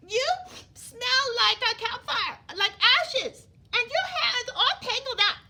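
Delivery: loud, high, sneering prince with a laugh; snooty prince. Acted. Prince Ronald scolding Elizabeth for her looks, loud and high. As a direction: look down your nose and scold loudly. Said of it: "This is what he said".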